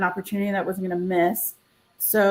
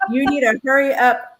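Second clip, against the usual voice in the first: mock urgent, a bit higher and louder; urgent retold friend; 5 semitones higher, 5 dB louder than usual. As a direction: hurry her along like a pushy friend. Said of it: "I'm laughing 'cause of the way you're like".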